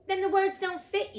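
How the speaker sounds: high, loud smug king. Acted. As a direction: deliver it like a smug joke. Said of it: "looking round the court with a smile".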